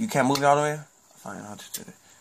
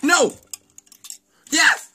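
high, loud exclamations; excited shouting; 13 semitones higher, 6 dB louder than usual. Real, not acted. Player reacting to the ball, excited shouts. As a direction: cry out each word high and hard. Reacts to near miss and goal.

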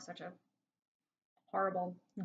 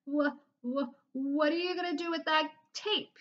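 put-on higher, stammering scared boy; frightened kid stuttering; nervous stammer; 9 semitones higher, 3 dB louder than usual. A narrator's reading. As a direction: force the words out timidly. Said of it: "got up the courage to speak".

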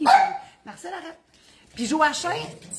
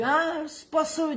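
doubtful inner voice; 6 semitones higher, slower than usual. Retold or imitated. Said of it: "ma petite voix... elle me dit".

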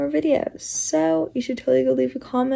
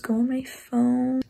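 usual speaking voice

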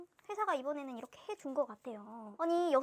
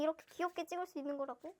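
ordinary talk

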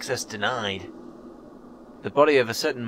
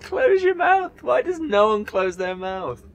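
scared giddy laughing; 3 dB louder, much slower than usual. Real, not acted. Laughing at glitchy animation.

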